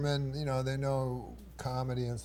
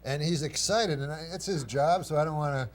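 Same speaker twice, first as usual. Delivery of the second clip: usual speaking voice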